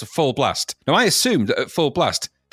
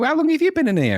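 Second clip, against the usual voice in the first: surprised shop worker; 13 semitones higher than usual. Retold or imitated. Shop staff finding trapped kids.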